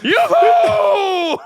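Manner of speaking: shrill high screaming battle cry; over-the-top cartoon hero yell; anime shout.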